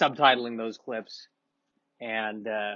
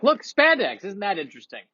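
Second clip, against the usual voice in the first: mock excited; 7 semitones higher than usual. Mock upbeat video voice.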